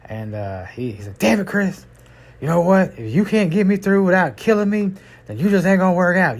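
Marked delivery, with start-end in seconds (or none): 1.2-6.4 raised, higher, sharp rebuke; mild mock yell at Chris; angry scolding boss. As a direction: scold the rookie driver who just threw you to the floor. Thrown to floor, boss scolds.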